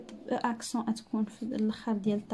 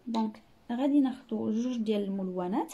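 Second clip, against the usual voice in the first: usual speaking voice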